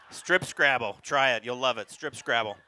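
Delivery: normal talking voice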